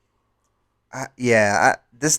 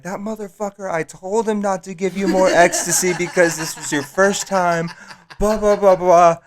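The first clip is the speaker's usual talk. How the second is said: higher, indignant Sarah impression with a laugh; mock angry girlfriend; 7 semitones higher than usual. Storyteller playing Sarah furious at her boyfriend. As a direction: pitch up and huff like Sarah.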